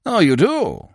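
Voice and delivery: high, loud scoffing retort; indignant scoff. A narrator's reading. Binet answers a compliment to his intelligence, indignant scoff.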